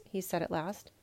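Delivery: ordinary delivery, nothing marked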